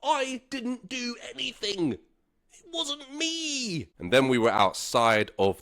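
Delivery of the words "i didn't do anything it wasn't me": high, slow, soft whine; whiny denial. Retold or imitated. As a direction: whine it one more time. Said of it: "I said it one more time".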